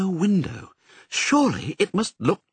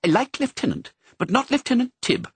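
prim cat voice; 12 semitones higher than usual. Acted. Cat objects to her name.

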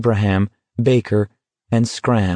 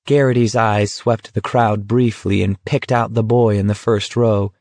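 ordinary delivery, nothing marked

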